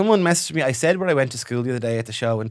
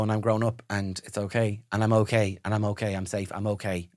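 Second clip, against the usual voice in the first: self-soothing; 4 semitones lower, 6 dB quieter than usual. Acted. Mock self-soothing therapy mantra.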